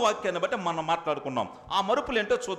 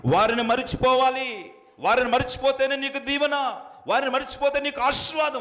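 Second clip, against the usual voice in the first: higher, louder preaching, pressing each phrase; fervent preaching; 6 semitones higher, 4 dB louder than usual. Acted. Preacher urging the church to leave worldly kin, fervent. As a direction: preach it hard to the congregation.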